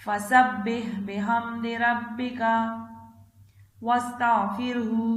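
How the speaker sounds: slow, drawn-out tajweed chant, phrase by phrase; Quran recitation. Acted. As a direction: chant each phrase slowly for the child to repeat. Third recitation of the surah.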